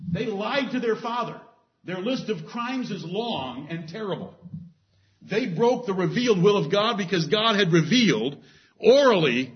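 high, driving list of sins; rising preacher's fervor; preaching at full force. Acted. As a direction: hammer each crime home from the pulpit.

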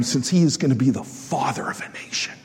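hushed whisper of God's words. Acted. Preacher voicing God to Abraham, in a hushed whisper. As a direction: whisper it like a secret confided.

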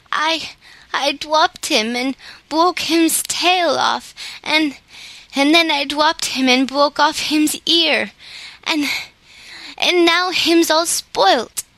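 put-on high, slow, stammering child explaining; little girl's voice. A narrator's reading. Mayre telling Claus how she broke her toy, high and stammering. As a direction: tumble out the story like an upset small child.

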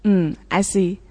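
normal talking voice

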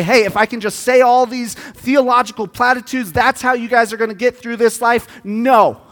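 preaching. Acted. Pastor preaching to the church, building up. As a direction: preach it with growing force.

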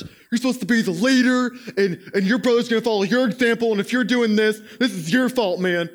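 lightly voiced angry dad lecturing his older son; mock dad yelling. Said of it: "So he starts yelling".

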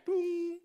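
high-pitched bell boom; imitated bell. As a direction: sound a high school-bell tone. Imitates the school bell ringing.

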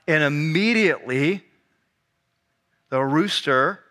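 low, measured Bible reading; scripture reading. A narrator's reading. Preacher reads Peter's denial to the congregation, scripture reading.

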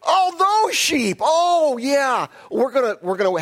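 high, loud mock sudden recollection; exaggerated caught-out realization; Saul. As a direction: feign remembering, hugely hammed up. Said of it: "That's what Saul does here".

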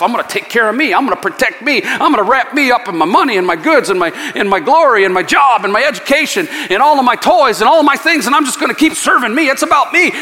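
a hint of a greedy hoarder boasting; mildly mocking me-first rant; selfish worldly voice. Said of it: "You just do everything you can to take care of self".

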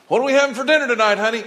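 quick, high, bright mock housewife; rattled off high as an eager spouse; mock spouse question. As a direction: play the cheerful spouse asking about dinner. Voicing a spouse asking about dinner.

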